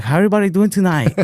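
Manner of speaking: high, put-on Chinese shopkeeper voice; high-pitched imitation of a Chinese comic on stage. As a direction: imitate the cartoon comedian. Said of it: "he's just like".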